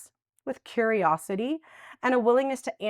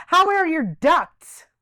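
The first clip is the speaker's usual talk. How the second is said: telemarketer; 7 semitones higher, 8 dB louder, much slower than usual. Retold or imitated. Imitating duct cleaning caller.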